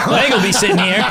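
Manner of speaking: high laughing aside; words through laughter. Real, not acted. Laughing line.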